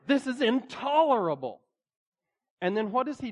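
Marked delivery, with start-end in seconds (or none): 0.0-1.6 loud, high mock outrage; exclaimed movie catchphrase. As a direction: exclaim it as an indignant film quote, no accent attempted. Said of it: "But he keeps saying".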